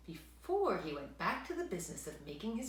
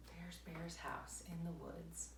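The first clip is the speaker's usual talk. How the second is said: whisper; 2 semitones lower, 15 dB quieter than usual. Real, not acted. Reader whispers aside to child.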